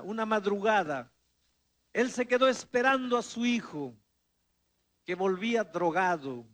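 ordinary talk, nothing marked

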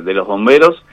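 usual speaking voice

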